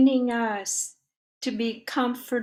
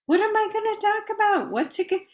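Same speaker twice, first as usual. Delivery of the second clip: high, quick anxious inner chatter; mock fretting; 10 semitones higher, much faster than usual. Speaker replaying her worry before a talk, mock fretting. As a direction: imitate your own worried thoughts, pitched well up.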